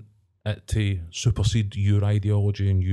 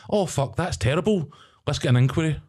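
mock shocked politician; 6 semitones higher than usual. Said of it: "is just to be like".

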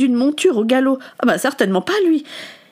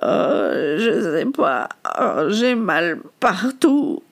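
dazed; 2 semitones lower, much slower than usual. A narrator's reading. Dazed after being thrown.

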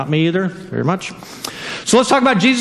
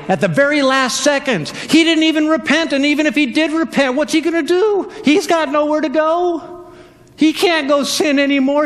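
ordinary delivery

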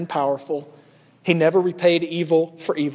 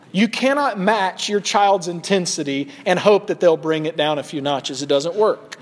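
normal talking voice

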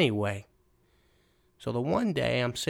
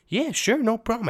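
put-on much higher, fast eager kid voice; 12 semitones higher, 6 dB louder than usual. Kid jumps at the chance to help, high eager voice. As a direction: squeak it out like a keen young kid.

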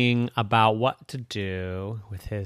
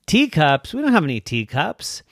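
lightly voiced dinosaur mom; 6 semitones higher, 7 dB louder than usual. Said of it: "And Mommy said".

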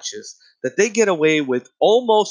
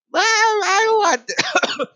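put-on very high, drawn-out kid wail; whining child; much slower than usual. Child told no in a store, whining. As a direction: bawl it like a spoiled brat. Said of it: "you can't say no. It's like".

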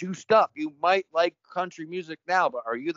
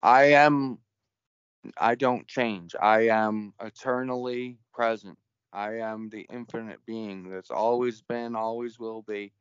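solemn proclamation; 7 semitones lower, slower than usual. Pretend. Mock proclamation as infinite being.

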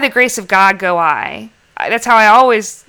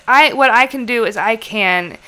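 ordinary talk

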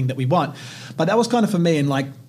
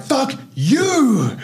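higher, defiant retold retort; defiant kid; 10 semitones higher than usual. Boy defying his stepdad again, higher defiant retort. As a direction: throw it back at him, higher. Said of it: "I'm like".

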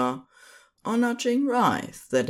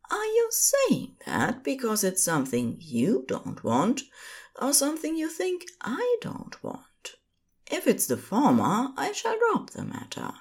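a tender angel, lightly raised pitch; gentle angel voice; 11 semitones higher than usual. Acted.

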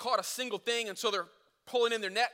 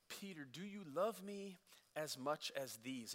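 much softer, lower, slow tender asking; gentle question; 4 semitones lower, 12 dB quieter than usual. Retold or imitated. Jesus quietly asking Peter aside, soft and tender. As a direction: ask it like a quiet confession.

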